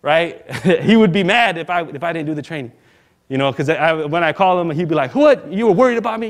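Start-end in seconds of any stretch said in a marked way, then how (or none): 5.1-6.3 high, loud startled WHAT; mock disbelief, pitch leaping up; retold incredulous dad. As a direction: imitate dad scoffing at the worry. Imitates hard-headed dad, incredulous.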